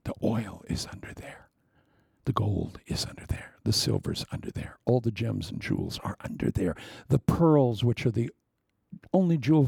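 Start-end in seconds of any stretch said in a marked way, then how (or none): 0.0-6.7 hushed, secretive whisper. Acted. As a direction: drop to a whisper as if revealing a secret. Preacher revealing hidden treasures.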